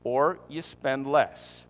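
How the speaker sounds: deliberately spaced, mock-patient repetition; slow repeat. Shown as a demonstration. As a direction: repeat it slowly as if they missed it. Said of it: "let me say it once more".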